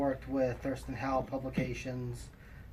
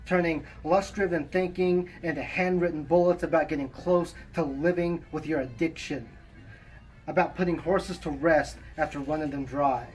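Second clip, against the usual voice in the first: poem recitation; 3 semitones higher, 5 dB louder than usual. Acted. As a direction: recite it with measured pauses between phrases. Reads own poem aloud.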